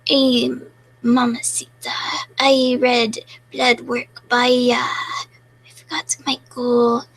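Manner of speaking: slow, hammed-up Mexican accent. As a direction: croon it like a cheesy Mexican flirt. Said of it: "I can do a Mexican accent".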